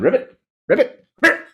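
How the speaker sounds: high, short frog croaks; little high ribbits, spaced out. A narrator's reading. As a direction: imitate a nervous frog croaking. Frog croaks as tiger approaches.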